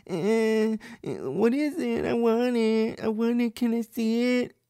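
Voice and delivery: put-on very high, drawn-out child whine; whining little girl. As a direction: mimic a begging little kid. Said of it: "the little girl runs up and she goes".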